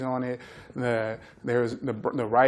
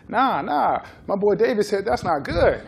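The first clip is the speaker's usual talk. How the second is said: laughing quote; 7 semitones higher, 6 dB louder than usual. Retold or imitated. Players defending their diet, laughing.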